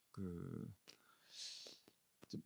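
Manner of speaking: voiceless hushed filler; whisper. Real, not acted. Pastor recalling a stuck dawn, whispered hesitation. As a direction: breathe it out, searching for the word.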